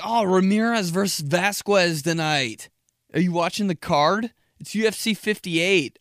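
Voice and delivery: hyped-up fight fan; eager sports bro chatter; excited fan voice. Retold or imitated. Mimicking UFC fans.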